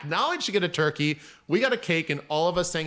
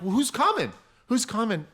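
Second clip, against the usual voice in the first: higher, pressing who's coming twice; concerned surprise; 7 semitones higher than usual. Retold or imitated. Asking the lonely birthday woman, with pressing concern. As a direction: urgently ask who's coming.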